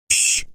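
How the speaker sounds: soft hushing hiss; shushing. Acted. Man hushing the group to hear the window, soft shush. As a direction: hush everyone at once. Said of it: "said Mister Teddy Henfrey".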